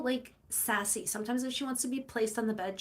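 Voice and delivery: usual speaking voice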